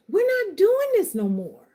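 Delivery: high, ringing declaration; on my pedestal. Real, not acted. Coach vowing to end the cycle, preaching high. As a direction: proclaim it with full conviction. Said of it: "Let me get off my pedestal".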